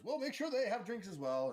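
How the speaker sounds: high, loud host's call; playful high-pitched character voice; host calling out orders. Acted.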